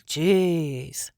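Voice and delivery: normal talking voice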